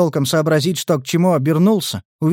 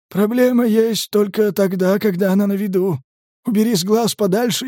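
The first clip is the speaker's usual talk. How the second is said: lightly voiced higher medic, philosophical; medic's voice; 6 semitones higher than usual. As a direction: muse calmly as the weary medic. Medic character voice. (неторопливый голос медика)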